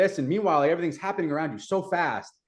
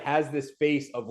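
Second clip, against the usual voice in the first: normal talking voice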